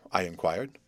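plain reading voice

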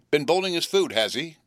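high sharp cry; shrill-pitched outburst of alarm; cried out. A narrator's reading. Sister cries out on hearing Pip bolted his food. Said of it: "cried my sister".

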